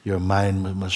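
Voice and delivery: ordinary talk, nothing marked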